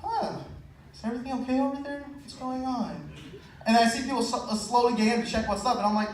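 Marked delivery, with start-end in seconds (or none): 0.0-3.1 nervous fake innocence.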